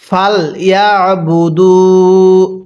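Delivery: very slow, drawn-out melodic recitation; slow chanted tajweed reading; Quran recitation. Shown as a demonstration. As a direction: recite each syllable long and sung. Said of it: "আরেকবার".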